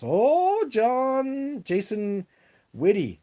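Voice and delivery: high, loud, long-held vowels on the name; drawn-out exclamation. Real, not acted. Host reacting to a viewer's question, exclaiming high. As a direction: sing out the name, starting very high and coming down.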